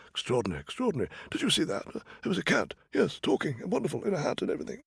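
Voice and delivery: high, hushed royal muttering, fast at the end; amazed king. Acted. King marvelling alone about the talking cat, high and hushed. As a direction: marvel quietly to yourself.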